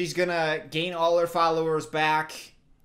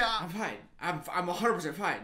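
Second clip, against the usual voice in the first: normal talking voice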